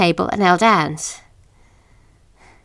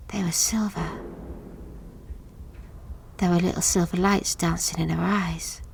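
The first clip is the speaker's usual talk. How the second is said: hushed whisper; 3 semitones lower, 11 dB quieter than usual. A narrator's reading. Narrator recalling the ghost's eyes, whispered. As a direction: breathe it out in an unvoiced whisper. Eerie memory of Sarah's eyes.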